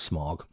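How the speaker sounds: Smaug said like "Smog"; mispronounced as Smog. Shown as a demonstration. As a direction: say "Smog", rhyming with fog. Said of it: "I thought it was pronounced".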